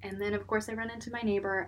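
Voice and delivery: normal talking voice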